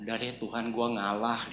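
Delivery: soft, subdued resigned murmur to God; inner surrender. Retold or imitated. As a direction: give in quietly, as if praying inwardly. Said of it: "saya dalam hati ngomong".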